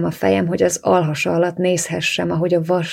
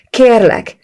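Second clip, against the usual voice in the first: high, loud desperate cry, falling away; panicked plea; 6 semitones higher, 10 dB louder than usual. A narrator's reading. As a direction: beg loudly and high, in panic. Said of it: "Estem pánikba".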